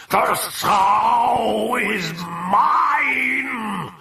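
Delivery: possessed roar, high and stretched out; coarse, powerful bellow. A narrator's reading. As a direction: bellow it slowly like a demon. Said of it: "bellowed in a coarse and powerful voice".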